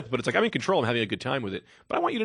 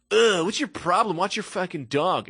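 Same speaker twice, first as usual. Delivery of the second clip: 1 annoyed lady; 6 semitones higher, 3 dB louder, slower than usual. Retold or imitated. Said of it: "then she goes".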